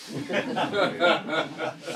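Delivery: hearty laugh at the punchline. Real, not acted.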